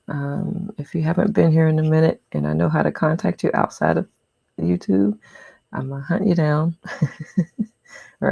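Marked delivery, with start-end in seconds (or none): none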